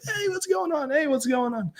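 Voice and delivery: very high, soft squeaky mock greeting. Whisky fan greeting familiar faces as he walks in, squeaky mock greeting. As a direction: squeak out a tiny imitation hello. Acting out his excited greeting.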